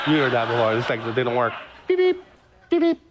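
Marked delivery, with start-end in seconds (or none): 1.9-3.0 high-pitched imitated phone chirp; squeaky two-note radio beeps; walkie-talkie beeps. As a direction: mimic a walkie-talkie's double beep, very high.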